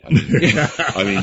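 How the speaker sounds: loud burst of laughter mid-sentence; laughing. Real, not acted. Brother laughing about his sister washing dishes, laughing. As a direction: break up laughing at the memory.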